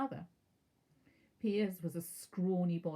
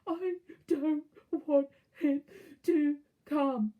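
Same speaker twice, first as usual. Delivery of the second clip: slow, loud, high bawling with pretend sobs; fake sobbing yell; 9 semitones higher, 8 dB louder, much slower than usual. A narrator's reading. Spoilt son faking sobs to his mother, loud and slow. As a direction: wail it like a spoilt child faking tears. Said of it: "yelled between huge pretend sobs".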